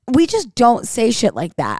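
ordinary delivery

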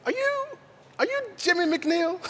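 high, soft starstruck question; excited stranger. Retold or imitated. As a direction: ask it like a starstruck fan. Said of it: "someone walks up and they say".

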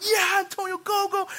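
high, loud cheering; excited yell. Retold or imitated. Fan cheering on a football player, yelling. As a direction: urge the runner on at full voice. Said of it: "why do you have to yell that loud".

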